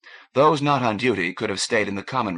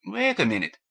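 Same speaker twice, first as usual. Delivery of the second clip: protesting; 9 semitones higher than usual. A narrator's reading. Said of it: "protested Stefan Doja".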